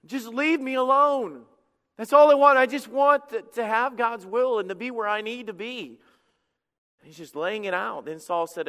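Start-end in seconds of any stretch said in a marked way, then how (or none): 0.1-6.0 higher, louder desperate cry; exhausted plea. Retold or imitated. David pleading with Saul to leave him alone, a desperate cry. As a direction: beg to be left alone at last.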